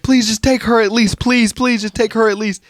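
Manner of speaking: high, urgent mock begging; retold high-pitched plea; imitated pleading mom. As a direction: beg like a desperate mother. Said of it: "the mom of the daughter".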